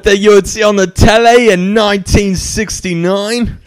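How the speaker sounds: high, mock old-fashioned British presenter; old British announcer. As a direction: talk like a sixties TV host.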